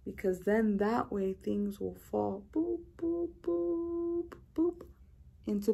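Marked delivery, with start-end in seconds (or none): 2.5-2.8 playful boops. Acted. Playful sound effect of things landing. 3.0-4.9 high squeaky boops. Pretend. Repeated boops higher.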